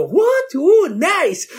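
high amazed gasp of what. Retold or imitated. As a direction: jump up high, thrilled.

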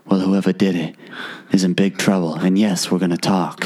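a hint of a calm but agitated rancher; stern resort owner. Resort owner scolding young guests, lightly voiced stern farmer. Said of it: "he's like".

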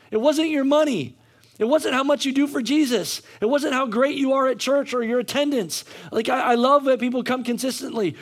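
fervent sermon delivery; preaching. Acted. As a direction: drive it home like a preacher building. Preaching at full force.

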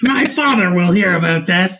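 lightly voiced spoiled brat, laughing through it; mock whiny rich-kid threat; Draco Malfoy line. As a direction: pitch up into a snooty quote. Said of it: "it's Draco Malfoy".

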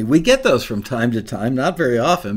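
ordinary talk

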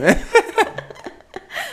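real laugh shooting way up; a squealing laugh at herself; high burst of laughter. Real, not acted. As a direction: genuine high-pitched giggle. Laughing at her own story.

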